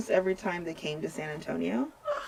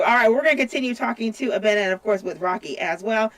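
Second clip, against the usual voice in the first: radio DJ voice; 5 semitones higher, 4 dB louder than usual. Acted. DJ announces the next song.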